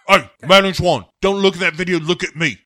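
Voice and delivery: high, loud, urgent calling out; Bond yelling. Acted. Bond begging Madeline not to watch, shouted. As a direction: shout to pull her attention to you. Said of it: "Bond starts screaming".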